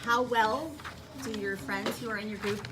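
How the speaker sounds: normal talking voice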